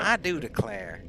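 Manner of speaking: slow Southern belle drawl; Southern accent. Pretend. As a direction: draw it out like a Southern lady. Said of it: "with our Southern accents".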